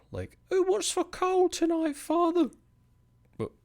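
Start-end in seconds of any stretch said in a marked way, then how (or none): 0.5-2.5 put-on very high falsetto, louder, like a comic old-fashioned child; made-up character voice. The streamer acting out a made-up family asking about supper, in a high falsetto. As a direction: squeak it out in a silly high put-on voice. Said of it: "those people that I just made up".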